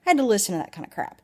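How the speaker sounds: rushed. Real, not acted. Rushed repeat of complaint.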